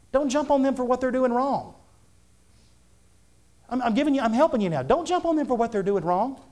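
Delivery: high, insistent pulpit exhortation; fervent preaching. Real, not acted. Preacher urging parents to pray for their children, high fervent preaching. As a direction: press the congregation hard, pitched well above talk.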